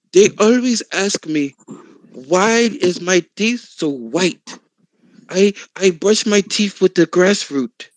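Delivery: slow, deliberate mock testimonial; imitated YouTube woman. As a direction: spell it out slowly, imitating her explanation.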